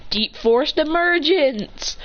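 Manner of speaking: slow, high dramatic announcement; drawn-out anime-style technique call; move call-out. Acted. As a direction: declare the attack name, drawn out. Dominic calls out his move.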